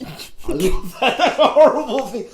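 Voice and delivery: laughing. Real, not acted. Gleeful about movie villains.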